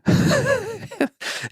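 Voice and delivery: high-pitched burst of laughter; amused laugh. Real, not acted. Dad tickled by his son's answer, laughing high. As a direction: laugh at your own punchline.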